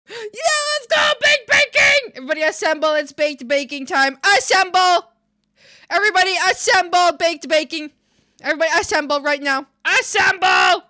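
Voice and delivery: high, loud call to assemble; rally the crowd, full hype; hype rallying shout. Real, not acted.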